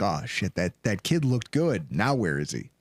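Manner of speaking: low, hushed worried mutter; lightly voiced player muttering in dismay; imitating cornerbacks. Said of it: "They're like".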